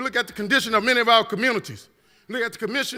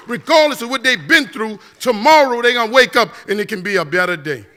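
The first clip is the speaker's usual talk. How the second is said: higher and much louder, a rousing call; full preaching; 6 semitones higher, 9 dB louder than usual. Acted. To Shreveport, a rousing sermon call, loud and high. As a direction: preach it out to the whole hall.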